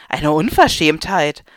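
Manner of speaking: loud, slow outraged exclamation; drawn-out indignant shout; indignant call. Acted.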